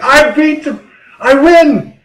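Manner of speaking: high, loud victory cry; excited kid. Retold or imitated. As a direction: shout it like a kid who just won.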